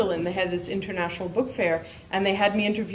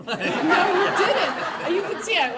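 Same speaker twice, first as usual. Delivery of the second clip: high, slow laughing reply; 11 semitones higher, 3 dB louder, slower than usual. Real, not acted. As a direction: laugh out the words, real amusement. Laughing at shared coincidence.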